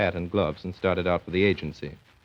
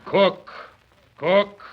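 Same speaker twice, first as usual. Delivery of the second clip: barked order; 9 semitones higher, 5 dB louder than usual. Acted. Father barking at agency clerk.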